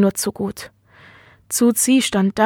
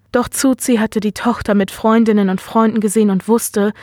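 ordinary delivery, nothing marked

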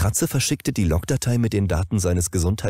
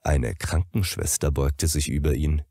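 ordinary delivery

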